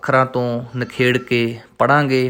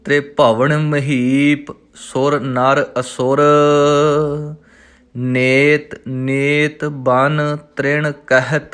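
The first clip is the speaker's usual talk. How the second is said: slow, measured recital of the verse; slow devotional reading; scripture recitation; much slower than usual. Shown as a demonstration. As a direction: recite each word slowly and clearly.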